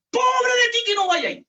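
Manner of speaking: grito de padre amenazando, más agudo; amenaza de papá. Retold or imitated. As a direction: grita la amenaza como un papá enojado. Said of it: "cuando los papás nos decían". (papá regañando a gritos a su hijo)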